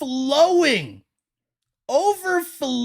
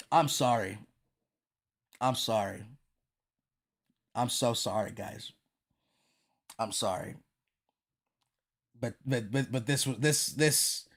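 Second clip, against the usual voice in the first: much softer, hushed and embarrassed; sheepish apology; 12 dB quieter than usual. Real, not acted. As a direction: mumble an apology, almost under your breath.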